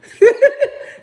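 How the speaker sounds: loud, high laughing fit; high burst of laughter. Real, not acted. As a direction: crack up out loud. Laughs at own story.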